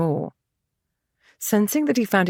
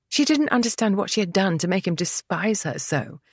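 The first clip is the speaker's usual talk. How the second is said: ordinary delivery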